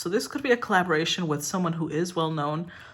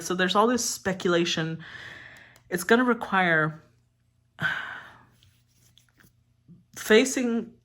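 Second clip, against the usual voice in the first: ordinary talk, nothing marked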